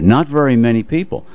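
ordinary talk

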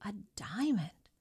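awed whisper. A narrator's reading. Wife stunned at the stone.

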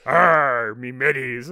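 hammed-up pirate cry; pirate growl. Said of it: "basically it's a pirate".

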